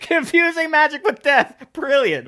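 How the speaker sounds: high, slow, laughing delivery; tickled and high-pitched; amused laughter. Real, not acted. Cracking up at the sketch.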